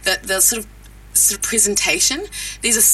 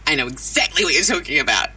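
laughing fake confidence; 4 semitones lower, faster than usual. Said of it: "sometimes I've been like".

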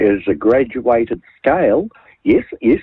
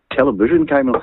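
normal talking voice

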